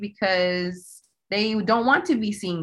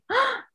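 retold gasp. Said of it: "we both said".

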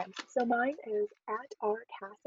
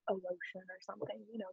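ordinary delivery

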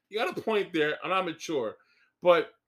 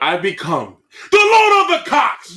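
loud, slow, booming declaration; grand shouted proclamation; 7 semitones higher, 13 dB louder than usual. Pretend. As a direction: announce it like a self-crowned king.

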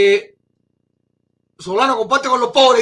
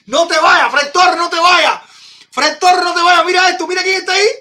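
higher and louder, urgent excited plea; excited calling out; 5 semitones higher, 5 dB louder than usual. Real, not acted. Streamer begs viewer Fred Torres to stay and watch, excited high call. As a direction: call out so he doesn't leave yet.

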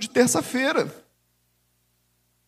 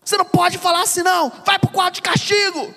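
put-on much higher, loud furious shout at a child; angry mother yelling; 10 semitones higher, 5 dB louder than usual. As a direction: scream at the kid like an enraged mother. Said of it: "se a minha mãe tivesse falado assim". (mãe gritando furiosa com o filho)

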